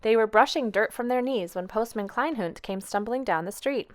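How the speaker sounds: usual speaking voice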